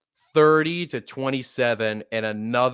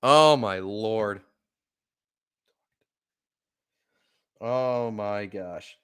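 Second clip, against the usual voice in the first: stunned; 3 semitones lower, slower than usual. Real, not acted. Stunned after the loss.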